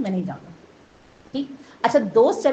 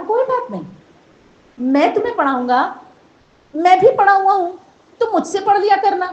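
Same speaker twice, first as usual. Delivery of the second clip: higher, louder encouraging voice of a stranger; reassuring stranger; 8 semitones higher, 7 dB louder than usual. Retold or imitated. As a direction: lift the boy up with the offer. Kind stranger comforts at grave.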